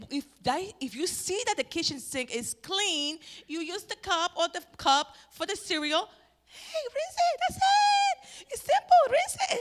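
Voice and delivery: high, loud nagging order; shrill-free mock nagging at the family; scolding. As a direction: scold them about the dirty cup. Scolding family about kitchen sink.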